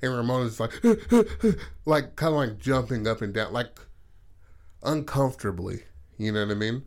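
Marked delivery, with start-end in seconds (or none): 0.9-1.8 high, loud panting giggle; mimicked giggle. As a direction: imitate jumpy, breathy bursts of laughter. Imitates Ramona's excited jumpy laugh.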